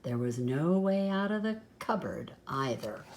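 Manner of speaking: ordinary delivery, nothing marked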